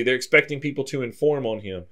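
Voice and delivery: ordinary delivery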